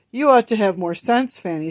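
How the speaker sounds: plain reading voice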